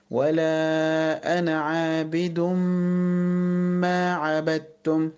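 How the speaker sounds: slow recitation, عابد ما joined by a long hummed m; idgham with ghunna. Shown as a demonstration. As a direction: hold the merged m of عابد ما. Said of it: "لازم أقول كده". (عابد ما بإدغام وغنّة طويلة)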